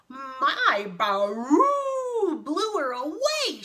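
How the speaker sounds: loud, high, slow boast; drawn-out elephant shout. Acted. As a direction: trumpet the BAROO. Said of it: "shouted the elephant".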